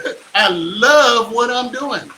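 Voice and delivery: retry it while laughing; laughing retake. Real, not acted. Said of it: "that was kinda weird on my face".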